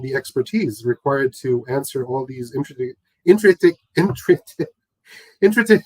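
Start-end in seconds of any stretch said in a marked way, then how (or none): 3.2-5.8 tongue-tied. Real, not acted. Tongue-tied, retrying a word, laughs.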